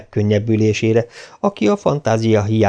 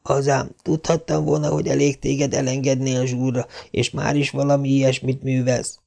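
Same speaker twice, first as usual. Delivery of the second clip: soft, higher mild scolding; Marilla scolding; 4 semitones higher, 4 dB quieter than usual. A narrator's reading. Marilla scolding Anne in relief, mildly. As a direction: grumble with relief. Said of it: "zsöltölődött Marilla".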